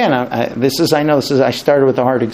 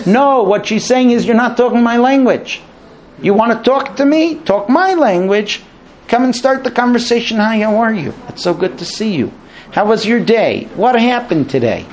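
higher, animated wife explaining herself; voicing the wife; 7 semitones higher than usual. Wife telling her husband to talk her language, animated. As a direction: speak for the wife, coaxing.

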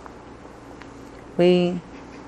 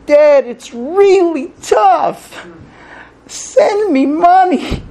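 pleading read; 11 semitones higher, 4 dB louder, much slower than usual. Retold or imitated. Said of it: "and one neighbor reads it that way".

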